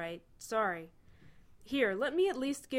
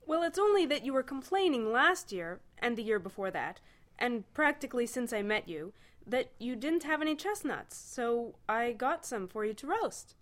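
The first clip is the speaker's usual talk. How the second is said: higher, eager beaming explanation; 6 semitones higher than usual. A narrator's reading. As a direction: explain it, pleased with yourself. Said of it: "Ford beamed".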